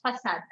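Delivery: façade as "fa-sad"; soft S sound. Shown as a demonstration. Teacher confirming the cedilla rule, façade with soft S. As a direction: say façade with an S in the middle. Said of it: "give you a sa sound".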